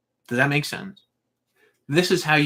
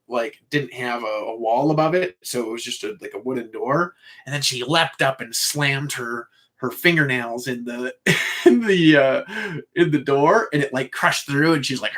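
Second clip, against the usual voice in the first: ordinary talk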